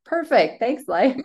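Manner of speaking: high and loud, bubbly thanks; chirpy mock delight. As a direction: toss it off like a delighted little cheer. Retold happy realization.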